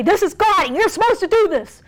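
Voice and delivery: high, nagging whine; whining complaint. Acted. Spouse pressuring family in God's name, whining. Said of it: "and cry and whine and complain and say".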